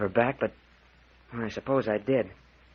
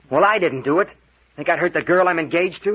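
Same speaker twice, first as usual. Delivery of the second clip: indignant outburst; 5 semitones higher, 7 dB louder than usual. Acted. Protests innocence, indignant.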